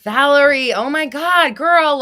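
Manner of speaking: loud, high, thrilled call-out; excited shout. Real, not acted. Streamer loudly greets Valerie in the chat, thrilled. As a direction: greet her like a long-lost friend.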